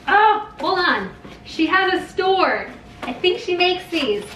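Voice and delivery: high-pitched, excited discovery; real excitement. Real, not acted. Discovering the gift maker has a store, excited. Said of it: "I get so excited".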